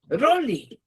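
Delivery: Rolly said high and prominent; emphasis on Rolly. Shown as a demonstration. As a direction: lift and stress Rolly. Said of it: "Enfatizando a Rolly".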